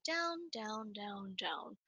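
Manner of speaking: going down low. Shown as a demonstration. Showing kids how deep a wolf howl goes, sinking low. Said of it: "if I were talking as a wolf".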